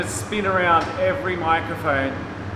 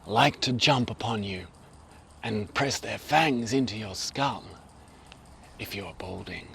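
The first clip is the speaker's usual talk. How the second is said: David Attenborough; 4 semitones lower than usual. Acted. Film-maker channels a naturalist into the lapel mic, hushed documentary narration. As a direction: murmur it close to the mic, dropping away at phrase ends. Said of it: "channel my inner David Attenborough".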